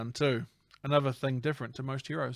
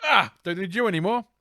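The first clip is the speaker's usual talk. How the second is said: higher, acted toss-off; mock dismissal; 6 semitones higher, 4 dB louder than usual. Phantom throwing villain from truck, mock dismissive. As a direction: toss it off in a raised voice.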